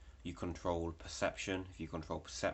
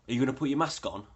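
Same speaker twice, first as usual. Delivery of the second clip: louder, higher demand; imitating a confrontation; 7 semitones higher, 9 dB louder, faster than usual. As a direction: push the question at him like an accusation. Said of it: "he's like".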